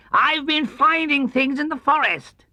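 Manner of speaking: high, slow, self-important boast. Acted. Tigger boasting to Christopher Robin, high and important. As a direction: puff up with pride. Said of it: "said Tigger importantly".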